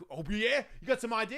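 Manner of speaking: loud, high retold taunt; super aggressive. Police confronting him behind the spa, aggressive. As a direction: challenge him like a hostile cop. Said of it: "getting super aggressive with me".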